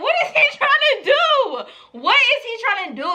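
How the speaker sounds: high-pitched, half-laughing disbelief; incredulous question. Retold or imitated. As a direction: ask it high, amused and baffled. Retold disbelief at grabbed bag.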